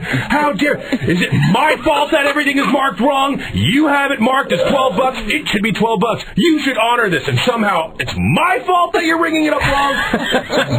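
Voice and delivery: very high, shouted indignant rant; acted high-pitched meltdown; freaking out. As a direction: re-enact an outraged customer losing all control. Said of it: "but I was freaking out".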